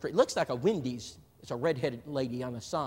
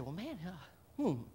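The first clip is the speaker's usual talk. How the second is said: slow, much softer puzzled mutter; muttering to himself; 11 dB quieter, slower than usual. Retold or imitated. Preacher retelling spotting a couple, muttered softly and slowly. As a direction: mull it over under your breath, slowly. Sees strangers in back pew.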